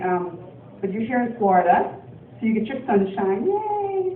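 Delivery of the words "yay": mock cheer. Cheering sunshine.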